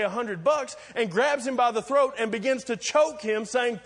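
high, loud, driving preacher's build; rising preaching. Acted. Pastor driving the story home, full preaching force. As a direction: preach it hard to the congregation.

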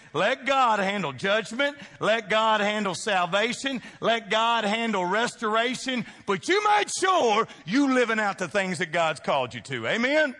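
rising preacher's call to the congregation; full-force preaching. Acted. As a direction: build the refrain like a revival preacher. Preaching builds to a shout.

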